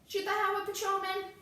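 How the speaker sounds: high, held-pitch accusing question; pointed interrogation question. Acted. Interrogator asks Sonny about the patrolman, pointed high-pitched question.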